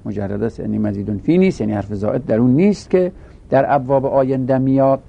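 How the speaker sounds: ordinary delivery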